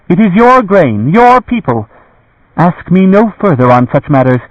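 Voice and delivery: high, curt noblewoman brushing them off; dismissive lady. A narrator's reading. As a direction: wave them away with cold authority. Morgaine's voice, dismissive.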